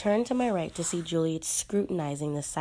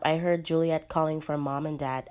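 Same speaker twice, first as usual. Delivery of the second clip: ordinary delivery, nothing marked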